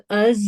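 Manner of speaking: از with a held buzzing Z. Shown as a demonstration. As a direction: close the teeth and buzz the Z in از. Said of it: "یہ basically جو ہے buzzing sound".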